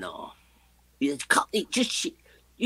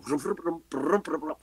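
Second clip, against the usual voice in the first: imitated drum fill; 3 semitones higher than usual. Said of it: "the drummer is going".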